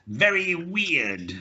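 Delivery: slow "very weird" with a clear V and W; slow V and W demo. Shown as a demonstration. Teacher drills Masiek slowly on V and W. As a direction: model "very weird" slowly, V against W. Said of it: "practicing things like".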